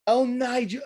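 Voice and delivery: high exaggerated commentator voice; a light take on Nigel; Nigel impression. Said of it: "I'll do Nigel".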